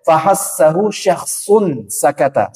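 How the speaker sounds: slow, drawn-out recitation of Fahassahu; Quran recitation. Shown as a demonstration. Teacher reciting the example slowly. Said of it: "reciting the example verse".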